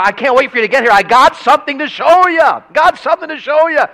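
high, loud eager mom voice; excited mom. Retold or imitated. Mom phoning her son, high and gushing. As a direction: gush like a mom who can't wait. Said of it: "she was so, you know".

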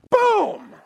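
loud, high, sudden "Boom!"; punch sound. Retold or imitated. The punch between the mule's eyes, loud boom. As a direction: bang it out like the blow lands.

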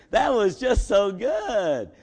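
delighted gush. Retold or imitated. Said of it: "She's like".

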